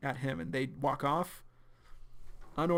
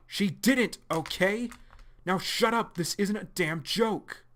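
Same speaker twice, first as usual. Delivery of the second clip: angry snap; 6 semitones higher, 5 dB louder than usual. Acted. Arlo snaps angrily at John.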